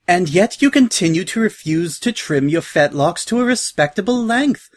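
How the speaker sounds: genteel lady's lilting disapproval; polite sighing reproach. A narrator's reading. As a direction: scold politely, with a put-upon air. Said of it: "said with a polite little sigh".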